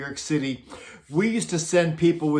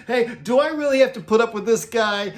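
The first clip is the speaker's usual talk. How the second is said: higher, put-upon whine to the stage manager; exasperated complaint; 6 semitones higher than usual. Retold or imitated. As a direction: plead to be rid of this pest.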